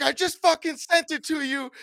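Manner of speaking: high, very loud re-enacted outburst; full-volume imitation of losing it; retold yelling. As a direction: yell it at your computer. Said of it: "I started yelling at my computer".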